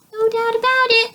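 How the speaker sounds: very high, loud little croak. A narrator's reading. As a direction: croak it out as a tiny talking book. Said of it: "croaked the smallest book".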